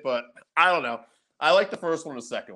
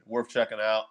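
ordinary delivery

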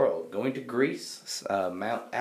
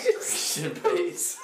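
laughing; 11 semitones higher, much slower than usual. Real, not acted. Cracking up mid-sentence.

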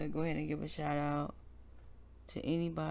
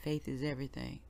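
heartfelt prayer; 5 semitones lower, 7 dB quieter than usual. Real, not acted. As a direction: pray aloud gently, like leading a congregation. Leads a prayer on air.

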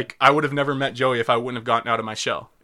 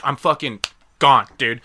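ordinary talk

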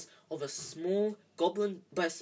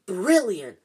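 loud, delighted exclamation; thrilled; 3 semitones higher, 11 dB louder than usual. A narrator's reading. Fred and George admire Harry's adventure, thrilled. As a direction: burst out with admiring glee. Twins thrilled by forbidden alley.

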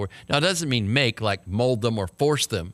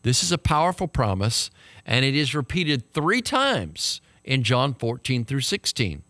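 ordinary delivery

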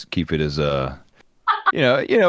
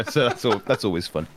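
normal talking voice

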